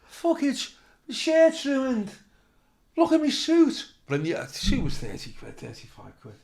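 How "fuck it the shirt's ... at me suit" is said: high, slow hammed-up complaint; mock outrage. Comic complaining to organiser about ruined suit, high slow wail. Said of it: "And I went".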